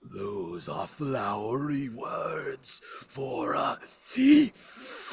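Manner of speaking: slow spectral voice rising to a cry on "thief"; haunting, drawn-out accusation; accusing ghost. Acted. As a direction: wail the last word like an angry spirit.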